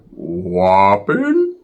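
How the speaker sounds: low, drawn out rising question; strange voice. Retold or imitated. Ricky turning around to the homie, low and drawn out. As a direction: ask it low and slow, unbelieving. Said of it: "I never heard a voice like that".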